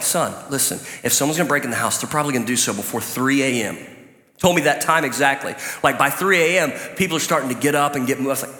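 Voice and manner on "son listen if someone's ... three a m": lower, softer fatherly voice; dad's advice. Retold or imitated. Father warning his son about burglars, low soft confiding. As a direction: lean in and confide like a dad. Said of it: "It's like".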